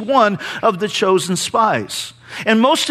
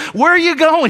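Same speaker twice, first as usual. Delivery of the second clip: higher, louder startled call after him; surprised call; 7 semitones higher, 4 dB louder than usual. Retold or imitated. Wife calls after jumping husband.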